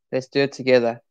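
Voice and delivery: ordinary talk, nothing marked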